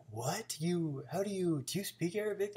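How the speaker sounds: high, amazed disbelief; imitating astonished friends. Friends amazed by his Arabic.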